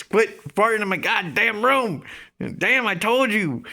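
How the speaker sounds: high, loud exasperated shouting; friend's angry yell. Retold or imitated. Friend yelling at him for farting in his room, loud and fed up. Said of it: "He says".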